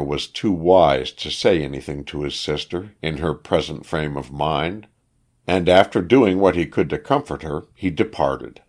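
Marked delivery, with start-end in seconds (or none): none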